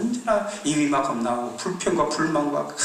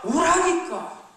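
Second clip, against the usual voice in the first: high, forceful command; older brother's last, forceful order to come home; demanding; 5 dB louder, much slower than usual. Acted. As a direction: bark the order from well above.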